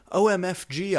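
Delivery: high, loud startled cry; excited yell. A narrator's reading. Narrator jumping up, yelling at intruders. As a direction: jump up and yell it. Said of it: "I yielded as I jumped up".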